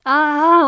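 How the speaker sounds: a sudden loud, high emotional "ah"; overwhelmed cry. Real, not acted. Gushing about a sweet scene, a loud overwhelmed cry. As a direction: burst out, fighting back tears. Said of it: "I'm trying hard not to cry".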